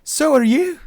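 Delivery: very high, loud astonishment; high, stunned Irish outburst; stunned exclamation. A narrator's reading. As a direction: blurt it back in shrieked disbelief. Stunned Irish woman answers back.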